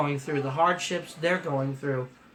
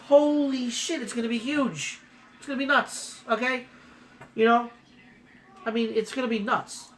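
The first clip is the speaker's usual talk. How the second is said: excited; 6 semitones higher than usual. Real, not acted. Reacting to the huge news.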